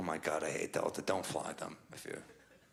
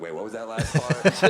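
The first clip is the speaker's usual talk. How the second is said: loud, laughing wait what; retold outraged question, half laughing; loud mock disbelief; 4 semitones higher, 11 dB louder than usual. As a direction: blurt it out through a laugh. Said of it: "I was like".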